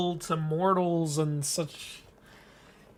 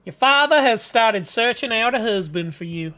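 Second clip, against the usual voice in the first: Southern mother. Acted. Mother telling her daughter about a husband search, Southern accent. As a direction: play a Southern mother announcing news. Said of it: "don't ask why I'm making them Southern".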